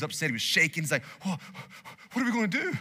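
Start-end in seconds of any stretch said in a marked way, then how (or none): 1.2-2.8 high, panicky mock fright; a frightened boy seeing the enemy army; worried servant. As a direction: voice the scared servant, pitched up. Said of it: "he was worried, he was shaking".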